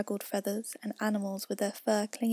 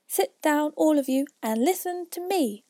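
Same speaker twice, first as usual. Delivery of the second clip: mouse calling out; 6 semitones higher than usual. A narrator's reading. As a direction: call them all to sit, pitched up. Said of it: "called out".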